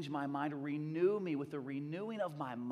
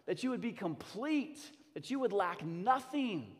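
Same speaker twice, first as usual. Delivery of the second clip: higher and louder rousing sermon; full-force preaching; 7 semitones higher, 5 dB louder than usual. Acted. Pastor rousing the church, preaching at full force. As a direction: drive the point home to the congregation.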